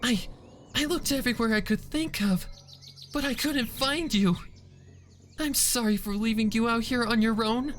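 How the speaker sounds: tearful. Acted. Fluttershy cries, sorry for abandoning Angel. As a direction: keep crying through the apology. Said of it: "Fluttershy cried".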